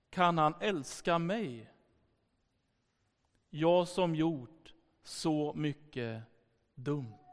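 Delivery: slow, sinking into a hushed confession; halting and low, fading to very soft; retold vulnerable question. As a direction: imitate a man quietly admitting his wrongs. Retold man's vulnerable final question.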